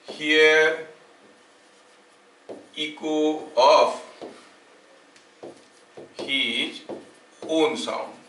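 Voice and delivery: slow, word-by-word dictation with long pauses; dictating notes. Acted. Teacher dictating a definition to the class, slow dictation. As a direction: dictate each phrase slowly so students can write it down.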